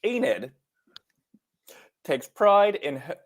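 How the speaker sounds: attempted British coming out as bad Australian; halting accent attempt, wobbling into Australian. Shown as a demonstration. Co-host reading the blurb haltingly, British accent turned bad Australian. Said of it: "it's a bad Australian".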